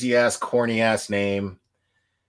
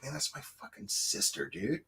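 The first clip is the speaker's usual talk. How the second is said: softly voiced brother's lament; a hint of the grieving brother, hushed; hushed quote; 3 semitones higher, 13 dB quieter than usual. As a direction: say it under your breath as him. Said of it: "He's just like".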